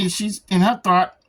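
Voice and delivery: normal talking voice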